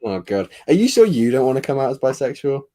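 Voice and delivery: usual speaking voice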